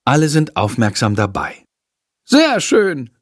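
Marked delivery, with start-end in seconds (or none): 2.2-3.1 high, delighted praise; pleased teacher. A narrator's reading. Teacher pleased with the rehearsal, high glad praise. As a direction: praise them gladly, much higher. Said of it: "freut sich Frau Schmidt".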